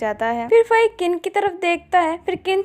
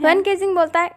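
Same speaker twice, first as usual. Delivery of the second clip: ordinary delivery, nothing marked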